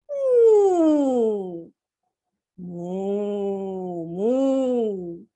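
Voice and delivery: low drawn-out moo; long mooing cow call. Acted. Imitates cow.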